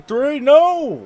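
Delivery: loud high yell; excited shout. Real, not acted. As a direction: cry out in alarm. Commentator reacts to near finish.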